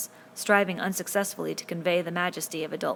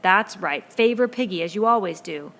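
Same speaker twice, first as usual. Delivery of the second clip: loud, bitter taunt; sneering; 2 semitones higher, 9 dB louder than usual. A narrator's reading. Jack sneers at Ralph for favoring Piggy, loud. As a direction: throw the accusation hard.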